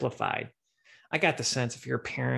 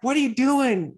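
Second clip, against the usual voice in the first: high shocked shout; mock shocked classmates; shocked students; 11 semitones higher, 7 dB louder than usual. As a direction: call it out in disbelief. Students mocking flashing classmate.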